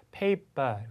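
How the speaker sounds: paper drawn out slowly, ending "pa" without R; slow British paper with a dropped R. Shown as a demonstration. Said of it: "R 사운드를 하지 않는다". (paper를 R 없이 천천히, 영국식으로)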